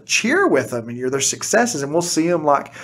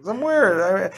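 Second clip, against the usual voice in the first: much higher, laughing disbelief; shocked and laughing; 10 semitones higher than usual. Retold or imitated. Teacher laughing in shock at a former student's height, much higher. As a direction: squeal in amazement at the giant student. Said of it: "I was like".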